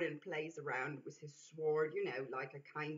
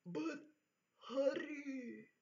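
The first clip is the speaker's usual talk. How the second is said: Neanderthal Yeti voice; 6 semitones higher, 3 dB quieter, slower than usual. Acted. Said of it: "Neanderthalic voice".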